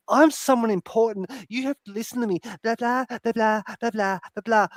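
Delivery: mimicking the woman talking down to the governor; mocking self-important. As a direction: parody her lecturing. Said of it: "she's fucking".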